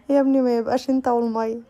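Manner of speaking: exasperated mom calling out; scolding son. Real, not acted. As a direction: call out to the son to stop. Mother scolds her child mid-video.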